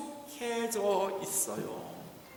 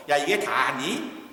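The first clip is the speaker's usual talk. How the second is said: scolding; 6 semitones lower, slower than usual. Acted. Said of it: "베드로가 야단쳤어요".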